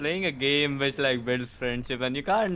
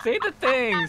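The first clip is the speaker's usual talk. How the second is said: laughing plea; 8 semitones higher, 3 dB louder than usual. Real, not acted. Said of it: "pleading repeatedly, laughing".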